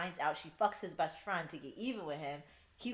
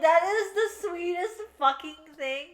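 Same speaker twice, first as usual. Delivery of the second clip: high, louder, gushing; gushing delight; 12 semitones higher, 10 dB louder than usual. Retold or imitated. Fan gushes to friend about a sweet scene, squealing. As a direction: squeal over how sweet it is. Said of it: "I'm like".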